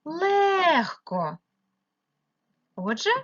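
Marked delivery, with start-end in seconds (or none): 0.0-1.4 Легко with a long, high е; Легко, stressed vowel held high; stressed check word. Shown as a demonstration. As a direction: draw out ЛЕ in Легко. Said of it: "яке можна перевірити".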